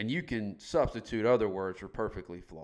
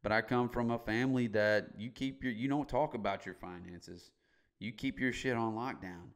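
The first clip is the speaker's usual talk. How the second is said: ordinary talk, nothing marked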